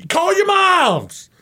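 high, loud, slow coach yell; mock coach shout. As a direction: bellow it across the cafeteria. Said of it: "he would definitely be a".

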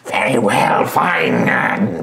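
exasperated clever goblin voice; grumpy goblin. Acted. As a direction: sigh out contempt for fools. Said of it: "a more sort of".

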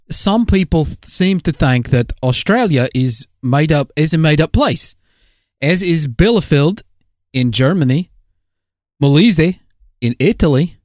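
chirpy Aussie co-host reading the news; Australian accent. Acted. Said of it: "switch back to our regular accents".

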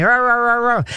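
high, run-together mock roars; dog roar imitation. Trainer mimics dog flying at a visitor, mock roaring.

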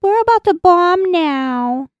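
put-on very high, slow, drawn-out cutesy child voice; cute little-girl voice. Soldier announces a bombing over the mic, cute little voice. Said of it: "in her cute little voice".

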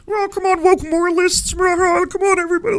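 Jordan Peterson as Kermit. Acted. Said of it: "Does he sound like Kermit? ... Yeah, Jordan Peterson".

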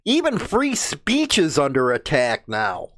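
ordinary talk, nothing marked

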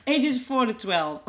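laughing through words. Real, not acted. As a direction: giggle through it. Laughing after tongue slip.

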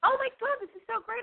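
high, delighted outburst; thrilled, pitching way up; excited squeal. Real, not acted. Caller's email recognized.

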